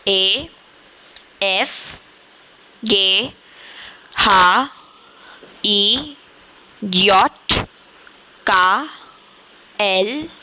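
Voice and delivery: each German letter name said slowly and clearly, one by one; German alphabet recited. Shown as a demonstration. Teacher recites the German alphabet for students to repeat. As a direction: spell out आ बे से डे as German letter names with pauses. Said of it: "एक बार one go में आपको ABCD बोल के बताऊंगी".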